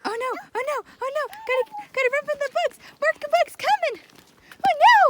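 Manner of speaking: high, loud, breathless alarm; playful panic. Pretend. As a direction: pretend to flee in mock terror. Pretend fleeing bugs, high loud.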